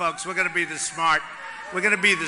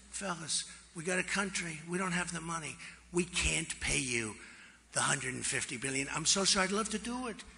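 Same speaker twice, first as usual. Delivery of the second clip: softened refusal; 3 semitones lower, 10 dB quieter than usual. Retold or imitated. Gently telling the Iran negotiators there is no money. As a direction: lower and softer, apologetic and friendly. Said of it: "So I'd go in differently now".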